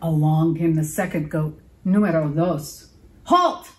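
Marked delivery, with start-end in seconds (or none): 3.2-3.7 high, loud scratchy halt; troll yelling at the bridge. A narrator's reading. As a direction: bark it at the second goat. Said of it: "yelled the troll in her scritch, scratchy voice".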